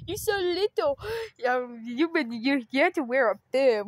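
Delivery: normal talking voice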